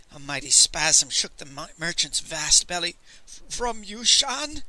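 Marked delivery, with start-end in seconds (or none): 3.3-4.6 slow, high stammered question; halting, higher-pitched query; hushed dread. A narrator's reading. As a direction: stammer it slowly in mild dread. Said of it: "he whispered".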